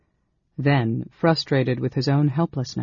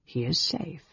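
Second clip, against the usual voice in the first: calm reply; 2 semitones higher, 7 dB quieter than usual. A narrator's reading.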